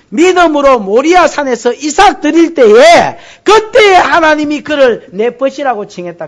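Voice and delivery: loud, slow, high preaching build; fervent preaching. Acted. Preacher to congregation on Abraham, loud fervent preaching.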